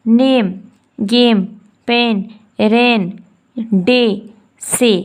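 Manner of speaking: नेम गेम पेन each read out clearly and separately; reading word list. Shown as a demonstration. Teacher reading example words aloud to students. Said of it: "देखिए".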